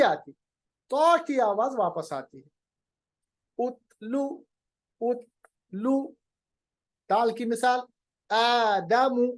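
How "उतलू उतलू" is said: उतलू drawn very slowly; slow recitation demo. Shown as a demonstration. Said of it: "आवाज को रोकिए".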